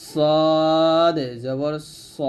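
very slow "সদ" with long, thick vowel; heavy letter, drawn out. Shown as a demonstration. Teacher modelling for learners, "সদ" slow and heavy. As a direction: stretch "সদ", end on a heavy "স". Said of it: "বলতে হবে মোটা করে".

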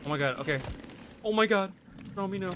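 ordinary talk

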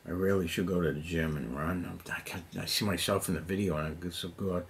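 normal talking voice

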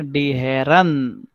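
very slow: Herren with -en; teacher modelling the plural; model pronunciation. Shown as a demonstration. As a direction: stretch die Herren out. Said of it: "teacher models plural".

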